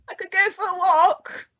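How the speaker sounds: high-pitched comic protest; Monty Python bit. Retold or imitated. Book presenter quoting a Monty Python sketch to a friend, high comic voice.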